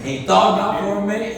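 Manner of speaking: ordinary talk, nothing marked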